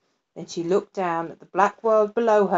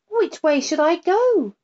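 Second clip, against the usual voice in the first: put-on higher child's voice; lightly voiced lost girl; the girl wonders alone in the dark, higher; little girl wondering; 9 semitones higher than usual. A narrator's reading. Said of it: "she wondered".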